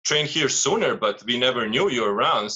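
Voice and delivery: ordinary talk, nothing marked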